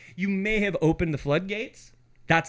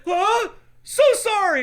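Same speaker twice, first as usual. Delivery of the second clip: high, loud sheepish squeaky apology; high mock apology; 6 dB louder, slower than usual. Friend voicing caught couple apologizing, high mock squeak. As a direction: squeak out a startled sorry. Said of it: "And they're like".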